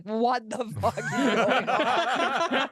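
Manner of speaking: slow, incredulous mock outburst; bewildered captain quote. Imitating the captain who is lost, slow laughing bewilderment. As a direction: draw it out in baffled disbelief.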